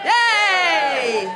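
loud, high whoop of joy; excited cheer. Real, not acted. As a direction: cheer the crew on. Host cheers techs on stage.

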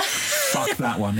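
high-pitched laughing rejection; laughing dismissal. Real, not acted. Laughing at the phrase hashtag blessed, high and amused. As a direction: laugh it off in disgust.

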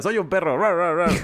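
playful dog growl. Retold or imitated. Child pretending to be dog.

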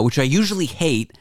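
ordinary talk

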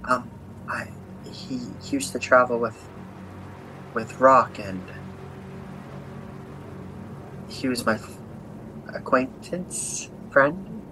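soft and halting, long gaps; hesitant, stunned. Acted. Sister explaining how she knew the dead man, hesitant and soft. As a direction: feel for each word, quietly unsure. Sister voiced over her friend's body.

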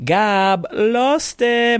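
high, drawn-out hype announcement of a name; big playful ring-announcer intro. Real, not acted. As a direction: introduce your buddy like a star guest, stretching it out. Hyping his friend by name.

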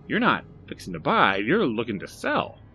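hearty shopkeeper greeting; Barry's eager voice. Acted. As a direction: chirp it like a keen salesman. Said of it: "said Barry".

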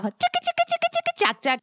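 very high squeaky "tchic tchac" noises; silly sound effect. Acted. Host adds a very high squeaky sound effect. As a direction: squeak out a tiny rhythm.

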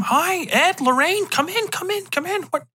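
high-pitched, welcoming host; happy to see them. Acted. Family welcomes Ed and Lorraine in, happy. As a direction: usher them in with acted delight. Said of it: "the people are happy to see him".

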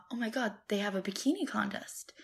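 breathy excited whisper. Retold or imitated. Retelling the film heroine spotting a contest, whispering. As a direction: whisper it like a secret thrill.

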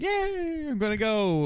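high-pitched drawn-out cheer; mock excitement. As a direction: imitate a giddy, eager reply up high. Imitates Donna's delighted reply.

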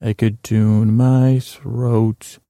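very slow, stretched-out words; drawn-out throat tuning. Pretend. Host demonstrates tuning his own throat to listeners, drawn out. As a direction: stretch each word as if tuning it.

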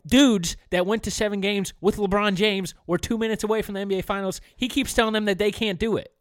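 loud exasperated venting at full voice; frustrated. Real, not acted. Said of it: "I'm very frustrated with this guy".

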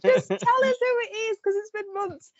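high whine of impatience; exasperated fan plea. Retold or imitated. Fan pleading with the TV after months of waiting, high and loud. Fans yelling at TV reveal.

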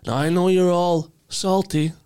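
a pompous crime boss gloating to his crew; Tooth Fairy mob boss. Acted. Tooth Fairy boss addresses his salty gang: high, loud smug swagger.

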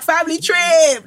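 high, drawn-out family-outing cheer; gleeful, slow, high call; mock cheering. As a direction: sing out like setting off on holiday. Mimics group cheering like family outing.

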